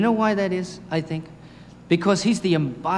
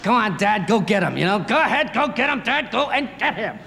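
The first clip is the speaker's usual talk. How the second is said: higher, eager cheering from the back seat; excited kids; 6 semitones higher than usual. Retold or imitated. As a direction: urge dad on like thrilled children. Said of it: "we got really excited".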